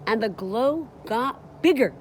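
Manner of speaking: usual speaking voice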